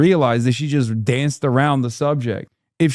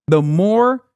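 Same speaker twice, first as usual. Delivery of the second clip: normal talking voice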